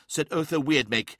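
ordinary delivery, nothing marked

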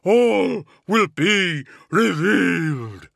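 high, loud, drawn-out demon voice; thundering monster. A narrator's reading. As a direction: boom it slowly as an otherworldly being. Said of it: "the being thundered".

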